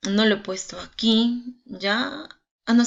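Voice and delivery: ordinary delivery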